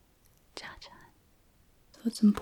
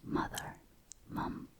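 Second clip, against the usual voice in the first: normal talking voice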